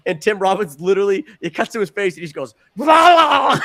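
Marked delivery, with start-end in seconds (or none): 2.8-3.6 loud, high mock vomiting noise; hammed-up gag as he throws up; retching blah. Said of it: "throws up all over himself".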